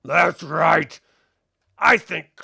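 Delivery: loud, cocky captain voice; boastful orc captain. Acted. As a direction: brag as you draw. Captain boasting with his bow.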